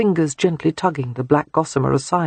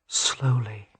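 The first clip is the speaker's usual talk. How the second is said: low, soft murmur; softer and lower, intimate; whispered; 4 semitones lower, 6 dB quieter than usual. A narrator's reading. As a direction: hush it close to her ear. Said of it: "he whispered".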